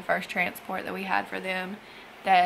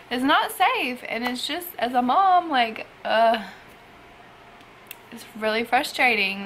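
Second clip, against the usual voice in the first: exasperated and raised, a worried mom; real frustration; 3 semitones higher, 6 dB louder than usual. Real, not acted. A mom upset over the kids' unsafe ride, raised and frustrated.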